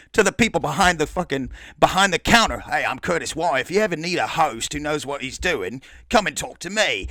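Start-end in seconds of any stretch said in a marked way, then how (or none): 2.6-7.1 Curtis Y. Retold or imitated. Rival pitching himself to the counter staff, mock Australian accent. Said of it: "more of a parody of an Australian accent".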